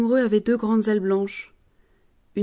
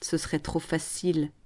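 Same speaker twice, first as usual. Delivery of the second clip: sneering; 3 semitones lower, 8 dB quieter than usual. Acted. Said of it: "Le promoteur ricane".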